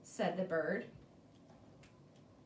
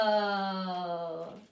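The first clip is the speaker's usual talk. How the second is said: lower, odd creature voice, steady; deep monster cry; 7 semitones lower than usual. Acted. Crab-bird-turtle announcing itself, low monster voice. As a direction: boom it like a monster.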